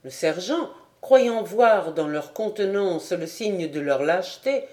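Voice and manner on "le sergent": usual speaking voice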